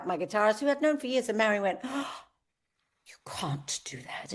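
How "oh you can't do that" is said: put-on hushed, whispered Holland Park accent; best Holland Park accent. Mary reproving the singer over the guitarist, whispered Holland Park accent. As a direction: imitate a shocked Holland Park whisper, drawn out. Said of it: "in her best Holland Park accent".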